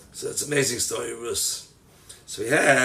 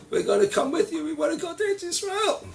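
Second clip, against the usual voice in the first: much higher, insistent plea; adamant young women; 13 semitones higher than usual. Acted. Daughters-in-law insisting to Naomi, high. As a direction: refuse to be sent back.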